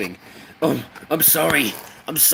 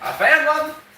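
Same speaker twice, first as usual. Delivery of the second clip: squeaky excited; 8 semitones higher, faster than usual. Acted. Squeaky found-it bit.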